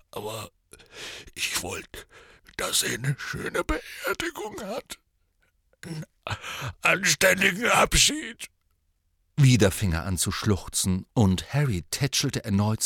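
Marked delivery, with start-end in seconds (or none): 0.1-5.0 choked-up, whispering through tears; tearful whisper. Acted. Grieving Hagrid breaks down. 5.9-8.5 bursting out through tears; sobbing outburst. Acted. Grieving Hagrid breaks down.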